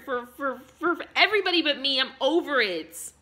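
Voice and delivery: put-on loud, furious drunken yelling; angry shouting. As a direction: shout it at the ex, no holding back. Yelling at ex about son.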